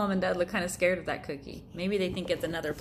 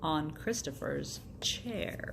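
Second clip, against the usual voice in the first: sly slow repeat; 3 semitones lower, 7 dB quieter, slower than usual. Acted. Sly repetition, slowed.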